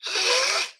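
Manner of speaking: a high strained huff; high wrong breath sound. Shown as a demonstration. Teacher mocking a wrong way to warm hands. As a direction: show the odd sigh nobody makes. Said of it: "Nobody's gonna go".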